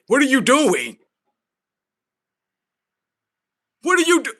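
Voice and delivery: high, loud, cutting himself off; loud exasperated rant; incredulous yell. Real, not acted. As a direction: shout in disbelief. Ranting about burger at Cheesecake Factory.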